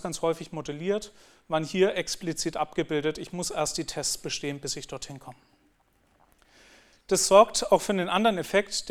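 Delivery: ordinary talk, nothing marked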